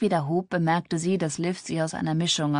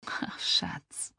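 soft, whispered sympathy; mitleidig; 7 dB quieter than usual. A narrator's reading. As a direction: breathe it tenderly to a hurting friend. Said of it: "Mitleidig verzog sich ihr Gesicht". (mitleidig geflüstert)

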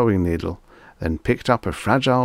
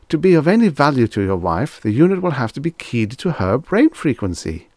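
higher, fast scared clerk; frightened; 7 semitones higher, 4 dB louder than usual. Acted. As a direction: hurry the excuse out nervously. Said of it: "the clerk answered in a frightened voice".

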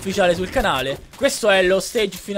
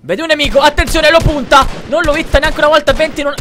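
excited commentary; 7 semitones higher, 9 dB louder than usual. Real, not acted. Live gameplay excitement.

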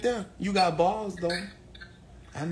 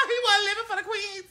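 high squealing laugh; 9 dB louder than usual. Real, not acted. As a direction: laugh through the words. Big laugh at joke.

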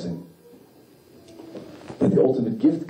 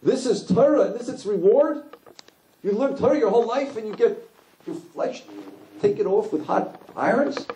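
high, protesting rhetorical questions; indignant angels; 12 semitones higher than usual. Acted. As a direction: plead against the injustice. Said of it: "And they say".

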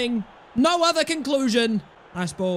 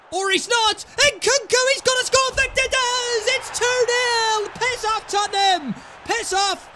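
goal celebration; 9 semitones higher than usual. Real, not acted. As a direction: roar as the goal goes in. Second goal scored.

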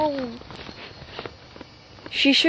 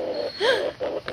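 gasp; 8 semitones higher, 7 dB quieter than usual. Acted. Sudden fright in woods.

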